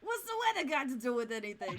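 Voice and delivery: gruffly dismissive Brooklyn detective; Brooklyn accent. Acted. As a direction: brush it off in Brooklynese. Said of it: "give him a Brooklyn accent".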